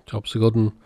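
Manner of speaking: usual speaking voice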